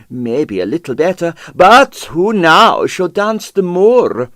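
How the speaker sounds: theatrical lament. Acted.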